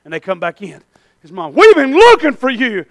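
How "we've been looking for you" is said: loud, lightly acted worried mom; a touch of exasperated mom; scolding mother. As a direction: call out as a mother who has been searching. Voices Jesus' worried mother scolding.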